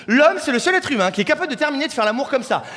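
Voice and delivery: ordinary talk, nothing marked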